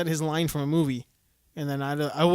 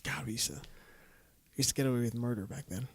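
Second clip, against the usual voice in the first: whisper; 4 semitones lower, 10 dB quieter, faster than usual. Real, not acted. Confessing old bar antics.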